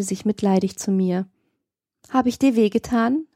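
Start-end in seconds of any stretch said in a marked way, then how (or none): none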